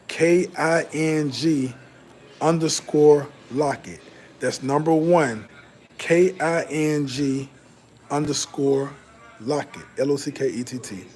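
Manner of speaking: slow letter-by-letter spelling of the handle; spelled out slowly. Real, not acted. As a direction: spell it out slowly so chat can type it.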